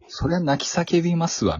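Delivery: ぐっと高い声で、大げさに同情して; 主人公に同情してわざとらしく言う; 大げさな納得. Retold or imitated. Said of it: "みたいなシーンばっかり". (高い声でおどけて同情する)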